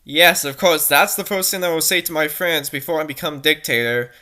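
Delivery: normal talking voice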